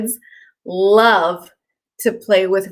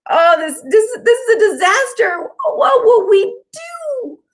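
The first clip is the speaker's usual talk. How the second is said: very high squeaky voice, slowing at the end; frantic cartoon scribbles wailing; tiny high-pitched voices in a panic; panicked scribbles; 12 semitones higher, 3 dB louder, slower than usual. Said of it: "The scribbles all cried".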